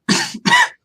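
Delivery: loud, sudden cough; real cough breaking into the talk. Real, not acted. Coughs, then says pardon me.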